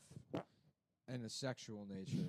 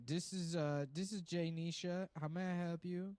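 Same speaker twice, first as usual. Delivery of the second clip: higher mock receptionist; hammed-up phone greeting; 6 semitones higher than usual. Receptionist answers the prank call, higher mock phone voice. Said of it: "receptionist answering".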